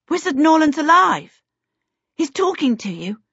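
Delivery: put-on a boy hears his master is alive; eager. A narrator's reading. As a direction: burst out with hope. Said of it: "Peter said eagerly".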